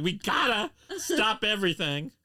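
high, loud mock urgency; hammed-up urgent announcement; urgent child excitement. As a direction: imitate an excited kid halting everything. Acts out his excited child self.

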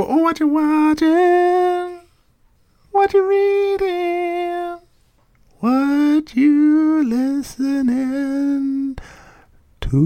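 high, slow falsetto sing-song; jingle voice impression. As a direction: stretch each question out in a thin high voice. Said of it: "when you don't get the voice".

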